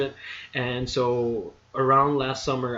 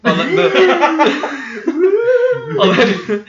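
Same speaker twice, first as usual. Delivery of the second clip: laughing; 11 dB louder than usual. Real, not acted. Cracking up at own joke.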